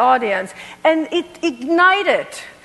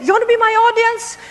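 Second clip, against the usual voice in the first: excited invitation; 6 semitones higher, much faster than usual. Retold or imitated. Inviting people to her show.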